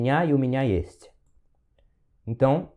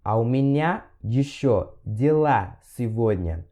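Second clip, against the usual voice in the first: slow model; 3 semitones lower, slower than usual. Shown as a demonstration. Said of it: "slow modelled repetition".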